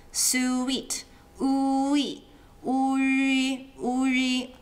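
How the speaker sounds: "Sweet" then "ui" with lips kept rounded; rounded ui glide. Shown as a demonstration. Teacher modelling the yu vowel, rounded lips. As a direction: keep saying oo into the ee. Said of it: "don't stop saying oo".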